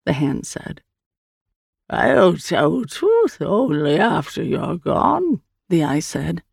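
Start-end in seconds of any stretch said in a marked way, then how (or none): 1.9-5.4 very slow, drawn-out alien voice; strange creature. Acted. The Eye answers Hand's question, slow creature voice. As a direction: stretch each word out as an otherworldly being.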